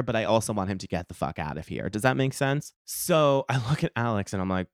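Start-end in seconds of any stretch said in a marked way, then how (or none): none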